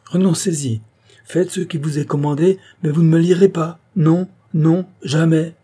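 high, loud, building refusal; king defying the executioners; crying out. A narrator's reading. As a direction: refuse to be tied, rising to a shout. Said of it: "s'écria le roi".

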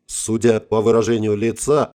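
ordinary delivery, nothing marked

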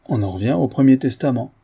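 plain reading voice

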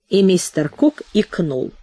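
plain reading voice

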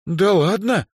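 high, disbelieving exclamation; astonished "no way" in a girl's voice; surprised. A narrator's reading. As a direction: react in amazement, pitch leaping. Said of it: "удивилась Эльза".

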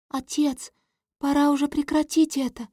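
high pleading princess voice; cartoon princess. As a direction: cry out high to her father. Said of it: "Слышу голос принцессы".

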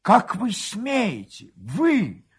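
slow, high-pitched accusation; indignant outrage. A narrator's reading. Husband confronting the man holding his wife, indignant outrage. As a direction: demand it in outrage, each word drawn out.

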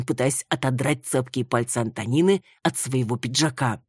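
ordinary delivery, nothing marked

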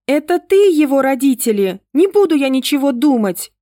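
high, loud tearful outburst; blurted out. A narrator's reading. Girl blurts defiance at her father, loud and high. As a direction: fire it back at him on the verge of tears. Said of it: "выпалила Ангелинка".

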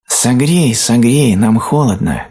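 high, loud little pleas; grass crying out. A narrator's reading. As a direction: plead as freezing grass blades. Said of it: "кричал трава".

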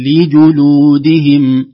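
"لجلودهم" said slowly, i then u clear; careful vowels. Shown as a demonstration. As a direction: keep the kasra and damma apart in "لجلودهم". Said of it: "تتابع الحركتين". ("لجلودهم" بكسر اللام وضم الجيم)

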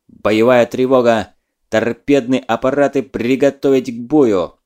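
loud battle alarm order; shouted command. Acted. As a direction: bellow the alarm to the crew.

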